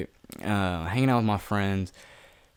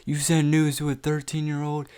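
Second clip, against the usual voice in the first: high-pitched imitation of shocked classmates; mock scandalized accuser; 7 semitones higher than usual. Classmates calling him out, mock scandalized accusation. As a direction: hold it high, mimicking their disbelief.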